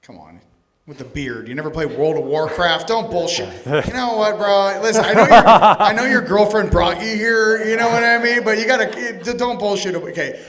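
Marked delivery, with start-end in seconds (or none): 1.5-9.9 loud, high teasing with laughter; laughing tease. Real, not acted. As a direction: rib the guy in the crowd, laughing. Teasing an audience member loudly.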